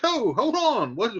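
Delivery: high, startled hold on; catching his nephew mid-storm; surprised uncle. Retold or imitated. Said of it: "he's like".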